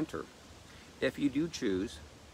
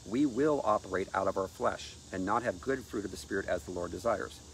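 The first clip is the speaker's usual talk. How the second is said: ordinary talk, nothing marked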